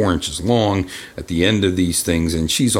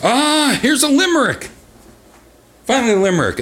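excited announcer. Acted. Announcing the limerick.

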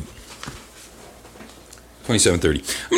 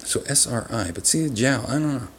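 ordinary delivery

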